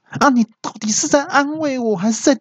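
very high, strained outcry, slowing at the end; angry yell. Acted. Heartbroken friend protesting the assistant's insulting comfort, angry high yell. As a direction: cry out high and indignant, stretching the end. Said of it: "我朋友就气到大叫说".

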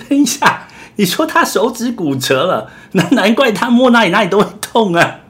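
high, loud, laughing reaction; amused high-pitched disbelief. Real, not acted. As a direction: talk through laughter. Host laughing through reaction.

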